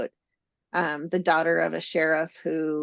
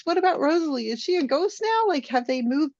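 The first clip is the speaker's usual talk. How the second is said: surprised; 9 semitones higher, faster than usual. Retold or imitated. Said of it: "I'm like".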